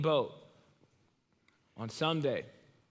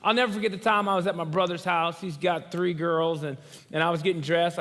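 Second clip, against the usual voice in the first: normal talking voice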